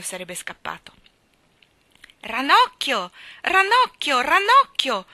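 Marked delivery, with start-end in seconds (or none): 2.2-4.2 loud high repeated yell; boy yelling for his dolphin; Ranocchio! yelled loud and high each time; yelling a name. Acted. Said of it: "urlò Michael". 4.2-5.1 short loud high yell of Ranocchio!; quick loud shout of Ranocchio!; yelled name. Acted. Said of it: "urlò Michael".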